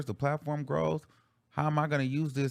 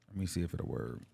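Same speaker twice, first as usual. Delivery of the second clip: ordinary talk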